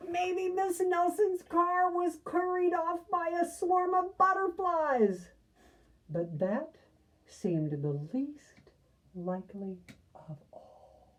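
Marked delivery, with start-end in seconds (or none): 0.1-5.3 high, smug know-it-all voice; a smug kid exclaiming, much higher. Acted. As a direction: say it like the smartest kid in class. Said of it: "exclaimed one know-it-all".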